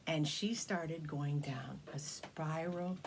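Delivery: slow suspenseful whisper. A narrator's reading. Storyteller building suspense on the slide, whispered. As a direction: draw it out, hushed.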